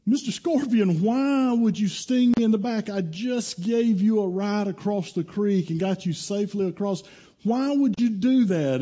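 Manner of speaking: high-pitched pleading protest; aggrieved frog. Acted. Stung frog asking the scorpion why, high and aggrieved.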